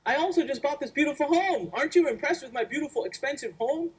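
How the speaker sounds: high, half-offended protest; host mock-protesting to his cousin, indignant. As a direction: play at being wounded about the house. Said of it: "and I'm like".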